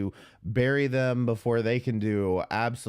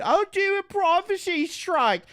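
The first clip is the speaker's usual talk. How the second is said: much higher, mocking sing-song; mocking quote; 5 dB louder than usual. Streamer mocking Malice's threat, high and sneering. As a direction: mimic her threat like it's ridiculous. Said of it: "with your stupid".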